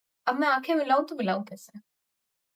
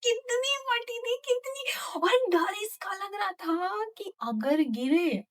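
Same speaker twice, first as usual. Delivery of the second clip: scared; 9 semitones higher, slower than usual. Retold or imitated. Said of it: "समझाना मुश्किल है".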